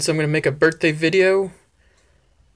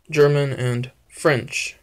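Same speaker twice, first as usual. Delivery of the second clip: ordinary talk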